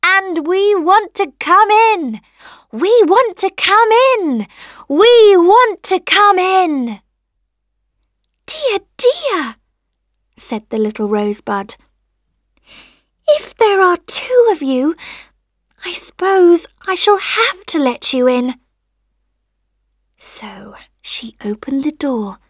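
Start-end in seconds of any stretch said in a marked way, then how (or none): 0.0-7.0 high, loud, slow pleading; two little voices. A narrator's reading. Rain and sun begging entry, high insistent chant. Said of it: "said two little voices together". 8.5-9.6 very high little voice; fretting rosebud. A narrator's reading. Rosebud at two insistent visitors, high fretting voice. 13.3-18.6 high little voice, conceding; rosebud relenting. A narrator's reading. Rosebud letting rain and sun in, high relenting voice.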